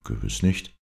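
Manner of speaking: plain reading voice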